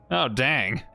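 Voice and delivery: ordinary talk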